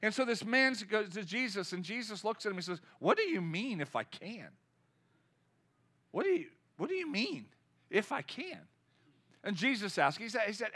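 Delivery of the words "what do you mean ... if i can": taken aback, throwing the words back; incredulous challenge. Retold or imitated. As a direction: question it in mock disbelief. Jesus challenging the father, retold.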